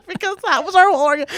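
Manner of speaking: high, fast words through laughter; giggling as she explains; laughing while telling it. Real, not acted.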